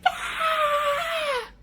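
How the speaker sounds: high imitated girl's scream; brief shrill mock scream. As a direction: squeal it like a scared kid. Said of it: "screaming like".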